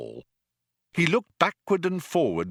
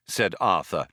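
ordinary delivery

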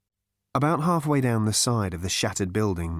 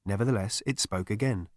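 usual speaking voice

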